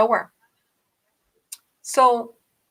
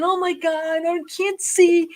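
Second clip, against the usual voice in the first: panicked; 6 semitones higher than usual. Retold or imitated. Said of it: "I'm driving".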